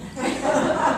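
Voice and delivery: high burst of laughter; a real laugh breaking out. Real, not acted. Laughs at ex's apology.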